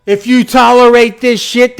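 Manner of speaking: high, loud and heated; loud rant. Real, not acted. Ranting to his viewers, heated loud shout. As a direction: shout the condition at them. Ranting against tolerating leftists.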